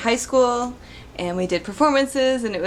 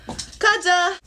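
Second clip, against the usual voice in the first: excited call; 10 semitones higher than usual. Real, not acted. Fan name called out.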